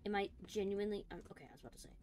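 usual speaking voice

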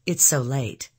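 usual speaking voice

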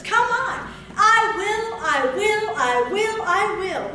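high, loud rising cry; fervent preaching. Acted. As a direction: stir the crowd with each I will. Preacher building fervour.

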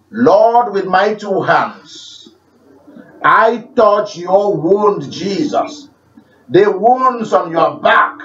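slow, intimate prayer addressed to Jesus; healing prayer. Shown as a demonstration. Showing the congregation how he prays for healing, slow and intimate. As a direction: pray it slowly, as if touching the wounds. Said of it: "listen carefully to how I pray for healing".

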